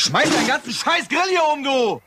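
high, heated threat, voice jumping up; angry outburst. Acted. Cop yelling at his friend Frank at the grill, furious. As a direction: blow up at him over the grill.

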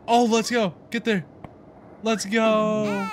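high, urgent cheer; excited cheering. Real, not acted. Gamer urging the runner on, excited cheering.

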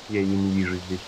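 ordinary talk, nothing marked

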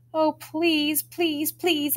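put-on high pleading child's beg; begging. Girl begging her mother for a slurping contest, high pleading. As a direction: plead like a kid wanting a treat. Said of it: "I beg".

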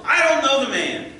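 loud, high, sworn denial; vehement denial. A narrator's reading. Peter denying Jesus with an oath, loud and high. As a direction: swear it off hard. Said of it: "denied it again with an oath".

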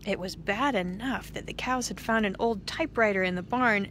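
ordinary delivery

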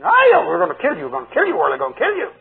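sudden high shout, then a snarl; furious demon threats down the phone; demon's rage. Retold or imitated. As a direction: rant like a raging demon. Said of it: "he'd fly into a rage".

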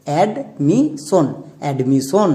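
Admission very slow, syllable by syllable, then repeated; slow model reading. Shown as a demonstration. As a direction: stretch admission out for pupils to copy. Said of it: "এবার একসঙ্গে উচ্চারণ করো".

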